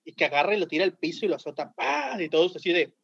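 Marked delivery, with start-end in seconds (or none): 1.8-2.2 high-pitched "pah" for a slammed bottle; imitated smash. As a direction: voice the smack of something hitting the floor. Imitates bottle hitting the floor. (imitando un golpe seco, agudo)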